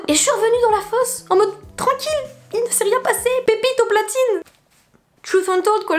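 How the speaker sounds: high, breezy as if nothing happened; mock nonchalant. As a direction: play it cool, innocently. (faussement détachée, voix aiguë)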